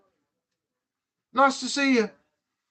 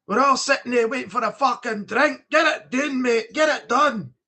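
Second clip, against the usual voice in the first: lightly voiced London pub bloke, demanding and rude; mild cockney nagging a mate to buy his round; rough London accent; 2 semitones higher than usual. Streamer plays a pub bloke in a London accent, nagging a mate. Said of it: "Accents as well".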